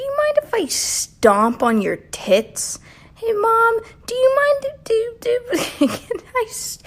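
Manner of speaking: put-on high whiny kid asking mom; squeaky bratty kid voice; mock child voice.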